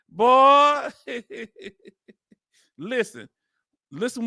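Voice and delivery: talking through real laughter; laughing. Real, not acted. Host tries to move on to the article while still laughing. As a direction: try to speak while still cracking up.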